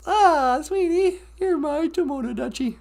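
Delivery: high, teary mock baby talk; mock sobbing coo. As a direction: gush at the toy bear in a fake weepy voice. Cooing at bear, mock crying.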